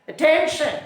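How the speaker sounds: short barked army order; commanding shout. Shown as a demonstration. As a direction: call it out like a captain. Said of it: "the captain gives an order".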